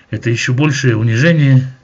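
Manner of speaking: normal talking voice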